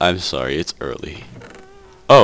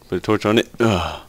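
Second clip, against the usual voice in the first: ordinary delivery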